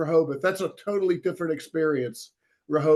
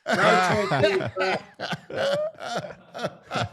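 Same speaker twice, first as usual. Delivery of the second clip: laughing; 2 semitones higher, much slower than usual. Real, not acted. Laughing at own joke.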